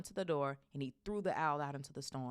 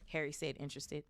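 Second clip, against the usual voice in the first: plain reading voice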